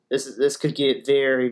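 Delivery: ordinary talk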